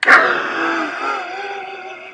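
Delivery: high burst of laughter after a scare; startled laugh. Real, not acted. Vlogger laughing after startling herself, high burst of laughter. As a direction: laugh off the fright.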